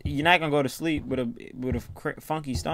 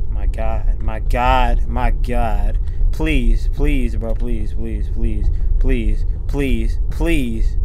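fearful pleading; 5 semitones lower, 6 dB louder, much slower than usual. Real, not acted. Frightened player begs the game.

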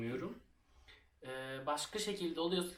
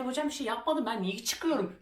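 much higher pleading pupil voice; a pupil begging the teacher, much higher; 10 semitones higher, 4 dB louder than usual. Retold or imitated. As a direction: beg the teacher, high.

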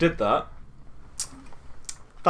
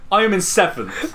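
ordinary talk

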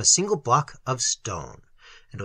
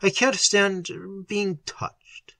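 slow, higher-pitched moan; 5 semitones higher, slower than usual. A narrator's reading. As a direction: draw it out in pain. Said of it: "he moaned".

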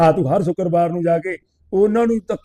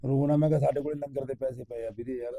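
soft comforting; 5 semitones lower, 11 dB quieter than usual. Retold or imitated. Comforting a crying man.

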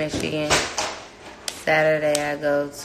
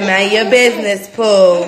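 playful scolding, high and loud; quoting him; 4 semitones higher, 9 dB louder than usual. Retold or imitated. Mother quoting the kid's sassy line, high and loud. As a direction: tease him to mind his business. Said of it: "He said".

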